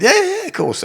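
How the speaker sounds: very high, loud string of yeses; acted eager agreement. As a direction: jump at the offer. Eagerly accepts the fight offer.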